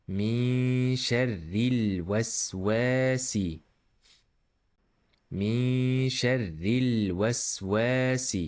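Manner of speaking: "من شر الوسواس" with hummed n, held l and long waa; measured recitation. Shown as a demonstration. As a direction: recite it as a model, sustaining each long sound. Teacher models the verse recitation.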